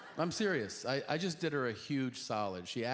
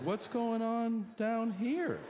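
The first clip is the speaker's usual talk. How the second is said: dramatic nurse; 9 semitones higher, slower than usual. Retold or imitated. Nurse lifts gown dramatically.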